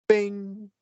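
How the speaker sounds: high, held chime sound; sudden sustained ding; high ringing bing. Retold or imitated. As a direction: ring out like a little bell. Imitates first taste hitting lips.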